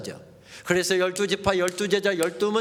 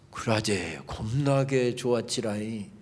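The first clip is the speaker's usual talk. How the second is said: slow, lower Jeolla saying; Jeolla dialect quote; 9 semitones lower, 3 dB quieter, slower than usual. Retold or imitated. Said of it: "사도 바울이 이렇게 이야기하고 있습니다".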